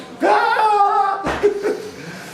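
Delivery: high-pitched cackle; high laugh. Real, not acted. Host laughs loudly.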